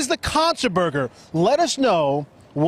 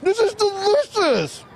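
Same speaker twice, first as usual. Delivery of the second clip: drawn-out delight; 12 semitones higher, slower than usual. Real, not acted. Tasting the deep fried ice cream.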